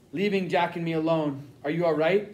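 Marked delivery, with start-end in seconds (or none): none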